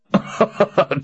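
high mocking laugh. A narrator's reading. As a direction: laugh her off. Jagang laughs at her.

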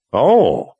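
a sharp startled exclamation; sudden realization. A narrator's reading. Kara realizing.

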